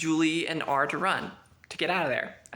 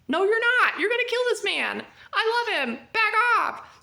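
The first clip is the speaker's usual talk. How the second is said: desafiante, aguda y a gritos; 6 dB louder than usual. Retold or imitated. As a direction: grita para que se alejen. Julie yells back at soldiers.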